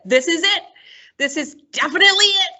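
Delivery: high, excited storytime voice; building excitement, high-pitched and quick at the end; excited announcement. Acted. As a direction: act out a thrilled kid's discovery, pitched high. Acted excited Ghostbuster in storytime.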